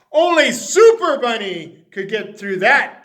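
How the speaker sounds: boasting about his bricked doors; declaring. Acted. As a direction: proclaim it proudly. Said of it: "declared Mister McGreely".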